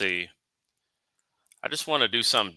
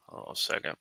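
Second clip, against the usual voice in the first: ordinary talk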